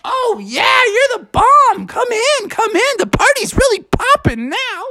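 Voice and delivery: swooping, excited host voice; grooving Froggy character; party-happy frog. Acted. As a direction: welcome her with hip enthusiasm.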